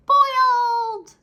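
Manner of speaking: high, very loud shout; rice shouts. A narrator's reading. Rice grains shouting their choice at Anansi, high and loud. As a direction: belt it out in triumph. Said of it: "the rice shouted".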